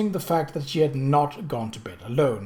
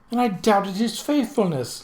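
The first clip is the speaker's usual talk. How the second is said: a higher, theatrical moan of regret; 6 semitones higher than usual. A narrator's reading. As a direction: moan it like a self-pitying lady. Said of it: "Lady Felicia moaned".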